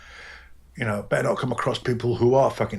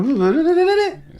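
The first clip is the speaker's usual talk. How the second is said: suddenly high-pitched; 4 dB louder than usual. Shown as a demonstration. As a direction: jump up into a much higher, squeaky pitch. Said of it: "I'm more higher pitched".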